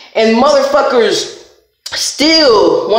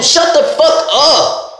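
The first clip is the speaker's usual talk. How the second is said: normal talking voice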